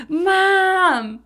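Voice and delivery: high, loud, drawn-out wail; desperate call for mom. Retold or imitated. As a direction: cry out for your mother. Calling out to rescuing mother.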